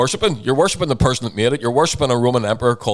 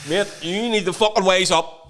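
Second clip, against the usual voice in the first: ordinary delivery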